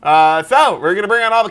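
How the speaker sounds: loud, high hyped host patter. Retold or imitated. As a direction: pitch it like a late-night host. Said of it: "here's an episode of Talking Dead".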